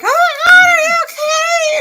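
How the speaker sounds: hammed-up high-pitched alarm; panicked sister. Sister rushing to her after the fall, mock panicked squeal.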